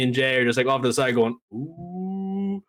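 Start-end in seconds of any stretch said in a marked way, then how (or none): 1.5-2.6 a high teasing falsetto ooh, held out; mock the drama with a high, soft ooh; typical Uso ooh. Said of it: "as typical Uso fashion".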